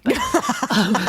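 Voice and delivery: normal talking voice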